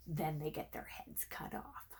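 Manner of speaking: whispered dark aside, unvoiced; grim whisper. A narrator's reading. Narrator whispering the gory twist to listeners. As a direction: let the gruesome news slip out in a whisper.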